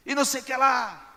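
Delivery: high, loud mock wife rattling on; ranting wife. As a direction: brush off the rest as the nagging spouse. Wife's rant voiced in story. (esposa reclamando, voz aguda e alta)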